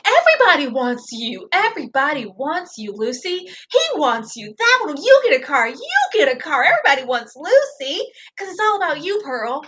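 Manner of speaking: hammed-up game-show giveaway swoops; mock fawning, pitch leaping up high on key words; mock gushing. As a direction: sing out each line with exaggerated sarcastic fanfare. Mock gushing retelling of flattery.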